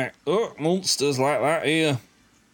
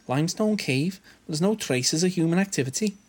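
higher put-on character voice; lightly voiced higher game character; Noah voice; 6 semitones higher than usual. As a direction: read the line with raised pitch. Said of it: "Noah says".

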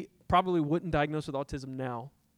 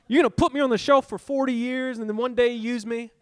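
retold angry outburst, high and loud; mad at God; 7 semitones higher, 9 dB louder than usual. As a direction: challenge the Lord in loud indignation. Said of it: "I was in a mad moment".

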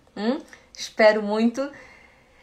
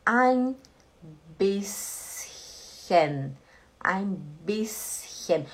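slow model phrase; 3 semitones lower, 3 dB quieter, much slower than usual. Shown as a demonstration. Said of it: "Repete todo mundo junto comigo".

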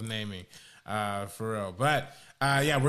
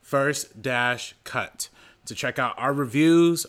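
ad read; 6 dB louder than usual. Acted. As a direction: read it like a sponsor spot. Website plug read.

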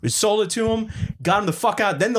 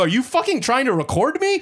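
high-pitched acted-out outrage; indignant challenge; 11 semitones higher than usual. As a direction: confront someone filming you, as retold. Retold challenge to man filming him.

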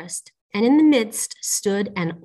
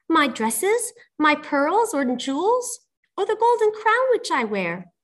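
very high princess voice; eager princess; 7 semitones higher than usual. A narrator's reading. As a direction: offer each treasure in a high, questioning lilt. Princess offers her treasures.

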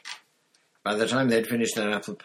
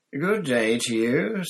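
high, slow, louder dreamy seer's voice; misty voice; 7 semitones higher, 7 dB louder, slower than usual. Acted. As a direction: glide in like a mystic from the shadows. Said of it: "the familiar, misty voice".

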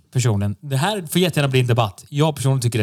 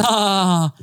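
mock laugh; 6 semitones higher, slower than usual. Mocking the clip's audience laughter.

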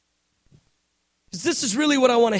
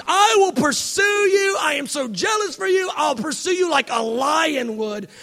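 God shouting; 3 semitones higher than usual. Acted. Preacher voicing God shouting his pursuit of Israel. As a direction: roar it out like a jealous lion.

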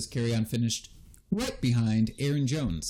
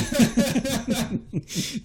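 bright burst of laughter; 11 semitones higher than usual. Real, not acted. Love fest joke.

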